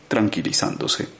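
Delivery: ordinary delivery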